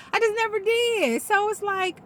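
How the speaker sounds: giggling through the words; laughing. Real, not acted. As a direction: laugh it off, voice jumping up. Laughs breaking into higher voice.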